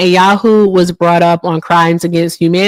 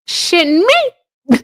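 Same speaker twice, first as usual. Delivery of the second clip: incredulous; 10 semitones higher, much slower than usual. Real, not acted. Repeats the question, stretched and high.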